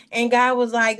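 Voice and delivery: ordinary delivery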